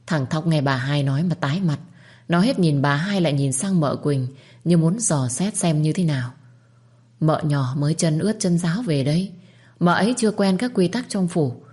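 plain reading voice

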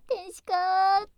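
drawn-out swooning cry; gushing. Acted. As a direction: gush it out, loud and slow. Gushing over girlfriend.

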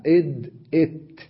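إد إت, d then t stopped short; إد إت said in isolation; letter sounds. Shown as a demonstration. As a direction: compare إد and إت. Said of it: "كيف نعرف مخرج الحرف".

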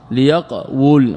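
ليقول said li-yaq-wul, vowelless q, then wu; split underlying form. Shown as a demonstration. Teacher demonstrating silent qaf, ليقول split as li-yaq-wul. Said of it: "بسكون القاف وضم الواو".

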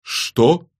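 high, loud, startled question; stunned, indignant soldier; taken aback. A narrator's reading. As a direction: blurt it in disbelief. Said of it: "опешил военный".

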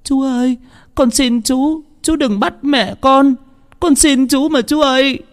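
put-on high, sobbing little girl begging the soldier; wailing child's plea. A narrator's reading. Little Nhi begs the soldier not to take her mother, wailing. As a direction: cry out like a small child clinging to a leg. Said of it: "gào khóc".

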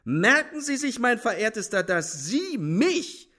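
very high, drawn-out incredulous outrage; haughty, loud rebuff; scornful indignation. A narrator's reading.

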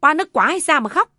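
mother-in-law bellowing in mockery; loud scornful yell. A narrator's reading. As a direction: yell at her for crying. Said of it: "càng to mồm gào lên".

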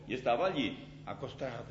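usual speaking voice

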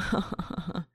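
breathy mocking guffaw; hyena cackle. A narrator's reading. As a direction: snigger like a hyena. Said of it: "Его ржание порядком надоело".

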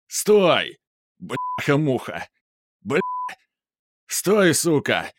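furious yelling while slipping; angry cursing shout. Acted. Мент падает, ругается. (злой крик с матом)

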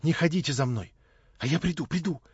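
imploring promise to return; pleading. A narrator's reading. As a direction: beg not to be followed. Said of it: "с мольбой, обратившись ко мне, сказала".

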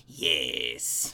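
very low, creepy villain voice; sinister emperor gloating in a deep croak; Emperor Palpatine. Acted. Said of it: "just going".

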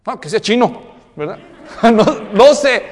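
broma entre risas; hija riendo. Retold or imitated. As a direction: hija que bromea y se ríe. Hija bromea y ríe. (hija riéndose)

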